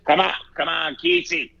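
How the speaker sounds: lightly imitating his mom pushing him to go; mom urging. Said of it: "She goes".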